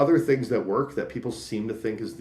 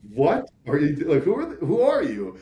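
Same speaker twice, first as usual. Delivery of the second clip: high, loud, puzzled what-are-you; a startled stranger at the door, voice jumping up; confused customer; 6 semitones higher, 4 dB louder than usual. Retold or imitated. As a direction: homeowner thrown by an odd question, louder and higher. Doorstep customer caught off guard.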